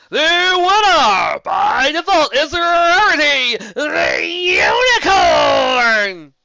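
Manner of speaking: loud, high, slow drawn-out announcement; announcer calling the winner to the crowd; ring announcer. A narrator's reading. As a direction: stretch every word like a ring announcer.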